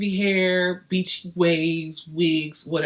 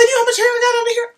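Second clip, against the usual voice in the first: high, loud, indignant challenge; outraged retort; 6 dB louder, much faster than usual. Retold or imitated. Snapping back at the rep about her weave, high and indignant. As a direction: throw it back at her, incredulous.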